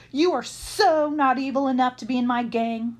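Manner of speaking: indignant, voiced boy villain; quarrelling. Mark rejecting his accomplice from his gang, loudly quarrelling. Villains trading insults while tied up.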